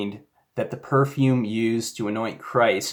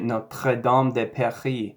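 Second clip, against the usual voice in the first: a hint of a French accent. Shown as a demonstration. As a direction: repeat "Notre Dame de Paris" the French way. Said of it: "try it in a bit of a French ac-, accent".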